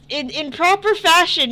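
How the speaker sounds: normal talking voice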